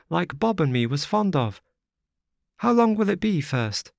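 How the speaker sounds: put-on high, childlike girl's voice; little girl. A narrator's reading. Little girl asks the wanderer if they will die, high childlike voice. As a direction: play a small frightened child asking. Child chatters about heaven.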